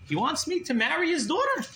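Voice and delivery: high-pitched disbelieving question; incredulous echo. Retold or imitated. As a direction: repeat it as astonished question, rising.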